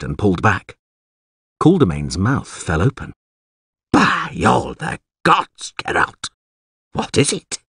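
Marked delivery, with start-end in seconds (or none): 3.9-7.6 drawn-out, high-pitched amazement; astonished oath. Acted. Alderman shown the dead monster, astonished. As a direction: stretch the oath, then ask in disbelief.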